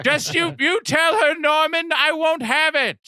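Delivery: put-on very high, scolding old lady; hammed-up indignant mother in falsetto; Norma Bates. Mother forbidding her son, mock-indignant old lady. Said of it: "you just hear over the P A".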